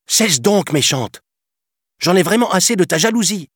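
put-on high, loud boy fed up; exasperated cry. A narrator's reading. Peter shouting at the jealous fairy, exasperated. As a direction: snap at her, fed up at last. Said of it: "s'écria Peter exaspéré".

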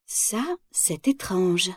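slow puzzled whisper; hushed and wondering. A narrator's reading. Reading his palm, puzzled.

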